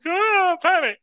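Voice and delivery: high, loud mock alarm; mock panic. Congregation member asked to preach, hammed-up panic. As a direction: squeal it like a frightened volunteer. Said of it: "you'd be like".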